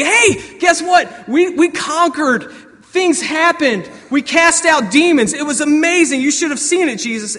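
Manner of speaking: high, loud, breathless-to-tell acted excitement. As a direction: shout the big news like thrilled returning disciples. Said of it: "But you guys are like".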